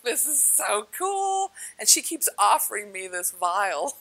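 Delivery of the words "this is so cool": slow, high, stretched-out wonder; wowed. Retold or imitated. Retelling checking out the pyramid floors, drawn-out high wonder. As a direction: draw out the delight, pitched up.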